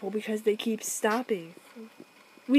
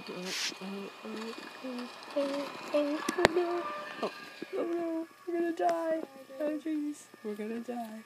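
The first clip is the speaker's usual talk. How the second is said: panicking gamer; 5 semitones higher, 3 dB quieter than usual. Real, not acted. Tornado about to hit.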